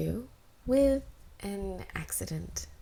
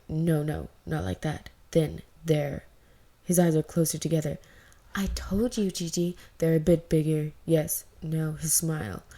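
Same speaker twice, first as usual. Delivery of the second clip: Wan Ji's voice; 3 semitones lower, 6 dB louder than usual. Acted. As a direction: lower and louder, firm instructing. Wan Ji describing Wei Ying.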